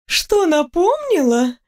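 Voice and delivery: высокий, протяжный вопрос русалки; переспроси нараспев, с лёгким смешком; ундина со смешком. A narrator's reading. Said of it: "хохотнула она". (лёгкая усмешка водяной девы, слова врастяжку)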